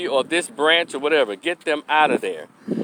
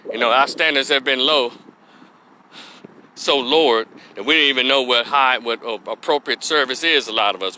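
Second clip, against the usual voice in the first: normal talking voice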